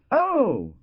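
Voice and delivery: high, loud acted cry of pain; pained exclamation. A narrator's reading. Farmer learning of his misfortune, pained high exclamation. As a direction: let the exclamation leap up in pain. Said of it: "exclaimed with an accent of pain".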